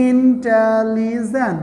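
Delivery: slow, drawn-out syllables in "intelligent"; "intelligent" slowly broken into syllables. Shown as a demonstration. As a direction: stretch out each syllable of "intelligent" to show how many. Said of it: "একাধিক".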